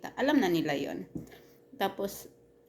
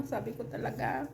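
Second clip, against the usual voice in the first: usual speaking voice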